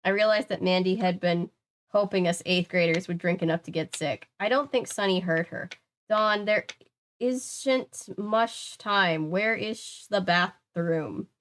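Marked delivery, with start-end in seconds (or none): 6.1-11.3 slow tipsy plea. Acted. Drunk Sunny urgent.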